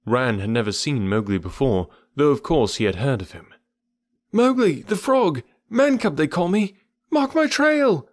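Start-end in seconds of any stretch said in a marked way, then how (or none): 4.3-8.1 high shrieking cry, flung out; shrieked. A narrator's reading. Mowgli shrieks his name while being carried off. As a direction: shriek it as you're swung away. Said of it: "The last words were shrieked".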